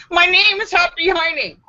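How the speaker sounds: put-on high little girl voice; insistent little girl. Girl insisting on her name to the teacher, high. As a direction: plant your feet like a stubborn kid.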